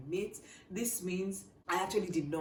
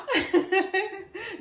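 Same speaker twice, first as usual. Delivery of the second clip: high, loud burst of laughter; real laugh; 9 dB louder than usual. Real, not acted. Vlogger recalling her panicked phone call, laughing. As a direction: crack up at your own story.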